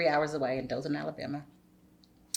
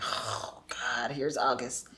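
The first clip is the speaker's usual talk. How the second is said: slow, sighed-out mock dread; weary dread; 5 semitones lower, 6 dB quieter, much slower than usual. Woman retelling how she dreads each August, weary. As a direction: let out a heavy breath, then drag it out quietly. Dreading her rough month, retold.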